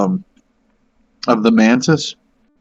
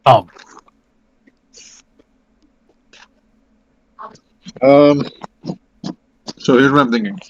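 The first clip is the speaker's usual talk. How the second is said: chewing while talking; 2 semitones higher than usual. Real, not acted. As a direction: mouth full of brownie. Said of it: "Sorry, I'm chewing a brownie".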